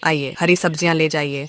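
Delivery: loud street hawker's call; calling out wares. Acted. Vegetable seller calling to villagers, loud hawker's cry. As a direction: call out to the whole lane to come buy.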